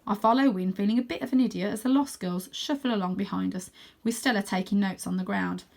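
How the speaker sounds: plain reading voice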